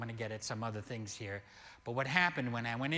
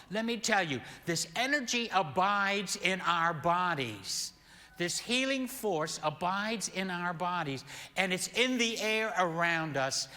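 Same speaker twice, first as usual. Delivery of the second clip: raised, louder preaching voice; impassioned preaching; 5 semitones higher, 4 dB louder than usual. Real, not acted. Pastor tells congregation about healing energy around us, impassioned preaching. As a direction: preach it high and forceful.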